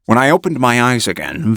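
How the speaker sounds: ordinary delivery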